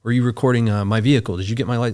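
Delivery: loud, hammering questions at her; barraging interrogation. Retold or imitated. Bodyguard boss barking questions at frightened woman, loud and aggressive. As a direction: grill her hard and loud, no pause. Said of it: "barraging her with questions like".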